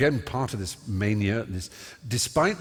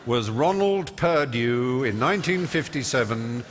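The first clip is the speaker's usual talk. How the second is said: slow, emphatic recital of a record; know-all recitation; slower than usual. Retold or imitated. Lippy young know-all reciting Guinness records to everyone, slow declamation. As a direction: declaim each figure with relish, drawn out. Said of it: "So I was able to say".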